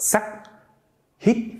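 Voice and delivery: "sak" and "hit" shooting sharply upward, cut short; high, quick rise on "sak" and "hit"; hard rising sắc. Shown as a demonstration. Teacher showing the hard tone that goes up, short high rise. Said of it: "and that just goes up".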